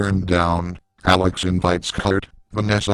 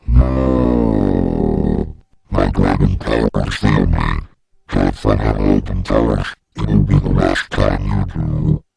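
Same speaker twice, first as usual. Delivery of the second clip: much lower and louder, a booming monster voice; monstrous dragon lady; 12 semitones lower, 4 dB louder than usual. Acted. The Dragon Lady teacher berates a student in a deep, booming monster voice. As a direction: growl it down low like a beast scolding a pupil. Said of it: "It's all like".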